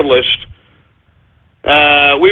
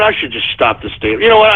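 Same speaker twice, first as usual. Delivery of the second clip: usual speaking voice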